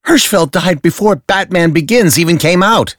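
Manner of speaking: high, loud, exasperated protest; he can't believe this nonsense; exasperated Dent. Acted. Dent exploding at Batman over the caricature, high and loud.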